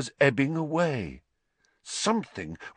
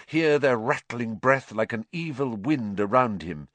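plain reading voice

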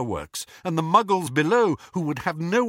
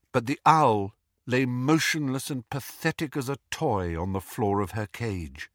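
ordinary delivery

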